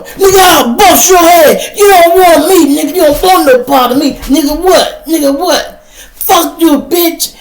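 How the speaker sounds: put-on loud, high drunken threats; sloppy drunk shouting at the counter; drunk yelling. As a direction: bark threats like a belligerent drunk. Drunk man yelling in store.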